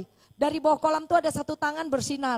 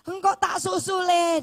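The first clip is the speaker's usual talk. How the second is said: wife's farewell; 6 semitones higher, 5 dB louder, slower than usual. Retold or imitated. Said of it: "dia bilang gitu".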